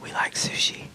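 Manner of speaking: hushed, breath-only imitation of dad; dad's whisper. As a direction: whisper it like a confidential secret. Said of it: "he would, like, whisper it".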